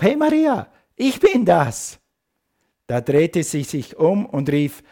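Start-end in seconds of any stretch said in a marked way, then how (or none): 0.0-2.0 calling out. Acted. Jesus calls Maria at tomb.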